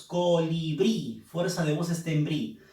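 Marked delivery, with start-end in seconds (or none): 0.0-1.2 colibrí stretched slowly, stress on brí; slow syllabified. Shown as a demonstration. Teacher sounding out colibrí slowly to find the stress.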